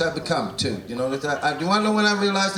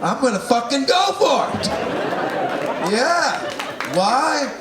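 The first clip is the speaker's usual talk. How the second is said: defiant excitement; 6 semitones higher than usual. Pretend. Comic Canadian telling Steve he crosses anywhere, a defiant, laughing cry. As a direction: declare it like a proud rebel.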